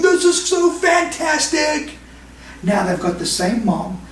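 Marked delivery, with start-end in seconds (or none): 0.0-2.0 high, slow, delighted mock shout; thrilled aunt. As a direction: act out the aunt loving the spinning ride. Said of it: "she was sitting there going".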